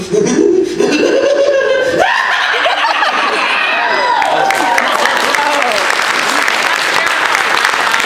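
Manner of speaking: loud maniacal shouting laugh; unhinged cackle, building and loud; crazy villain laugh. Shown as a demonstration. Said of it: "That's more the Kafka laugh".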